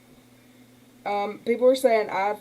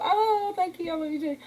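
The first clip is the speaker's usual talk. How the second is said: pleasantly surprised; 8 semitones higher, 4 dB quieter than usual. Real, not acted. Touched by a viewer's donation.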